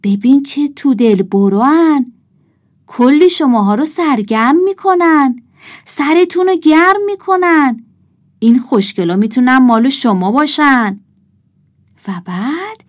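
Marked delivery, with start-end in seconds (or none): 0.0-11.0 loud, high, singsong sales cry; hawker's shout. A narrator's reading. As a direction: call out to the crowd like a street seller. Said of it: "مرد کهنه خر فریاد زد".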